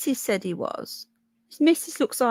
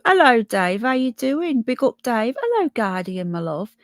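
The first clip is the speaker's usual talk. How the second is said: usual speaking voice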